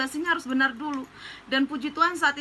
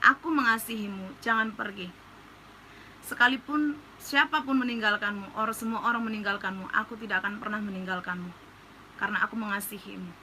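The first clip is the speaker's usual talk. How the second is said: soft, low, tender reassurance; hushed imitation of loving comfort, no sobs; Tuhan Yesus weeping; 4 semitones lower, 5 dB quieter than usual. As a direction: reassure gently, voice sinking softer. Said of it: "saya melihat itu Tuhan Yesus nangis".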